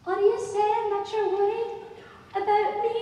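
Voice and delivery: put-on high, loud, slow child voice. Child asking the adult about their worry, childlike. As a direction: pitch it way up as a questioning child. Child figure asks the narrator.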